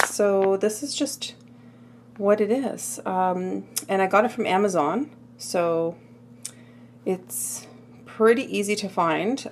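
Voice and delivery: ordinary delivery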